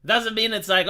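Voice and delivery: ordinary talk, nothing marked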